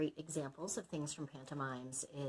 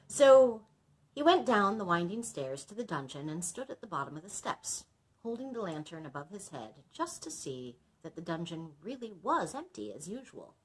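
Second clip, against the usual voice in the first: plain reading voice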